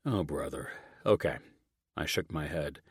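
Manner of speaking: usual speaking voice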